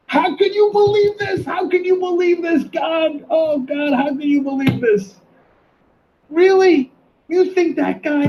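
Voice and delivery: high, drawn-out cries of disbelief; exasperated yelling. Real, not acted. Host berating viewers who believe a scam artist, exasperated yelling. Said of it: "I don't wanna yell at you".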